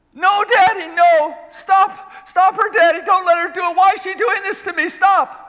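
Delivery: put-on high, loud pleading cries of a small girl; a terrified toddler begging her father to stop; imitating screaming child. Retelling how his daughter screamed at him during a spinal tap, in high, loud, frantic pleading. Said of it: "and of course screaming".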